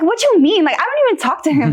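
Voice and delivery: high-pitched, fast, half-laughing disbelief; incredulous retort. Retold or imitated. Daughter answering mom's accusation, incredulous, high and quick. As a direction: toss it off like the accusation is absurd. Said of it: "And I was like".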